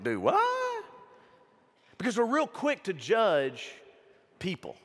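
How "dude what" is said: squeaky high double take; mock bafflement. Preacher recalling reaction to Kanye's album, high mock bafflement. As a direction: shoot the pitch way up in disbelief. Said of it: "including me, was like".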